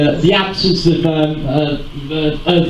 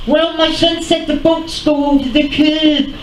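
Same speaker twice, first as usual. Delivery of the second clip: mimicking him; 9 semitones higher than usual. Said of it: "he'll say".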